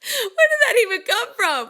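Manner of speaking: high, loud, disbelieving yelp; a real jolt of shock, cracking up; stunned disbelief. Retold or imitated. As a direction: caught totally off guard. Stunned by the singer's question.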